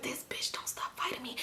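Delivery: voiceless, exasperated inner whisper; stressed-out whisper. Retold or imitated. Stressed out mid-fight with her sister, exasperated whisper. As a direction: whisper it to yourself in mock despair. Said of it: "I was stressed out because I'm like".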